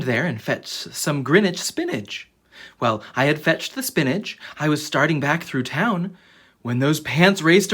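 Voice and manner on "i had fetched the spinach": plain reading voice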